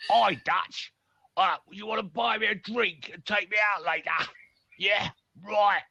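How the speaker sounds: high, squeaky silly voice; changed voice. Shown as a demonstration. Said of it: "All I did was change the voice".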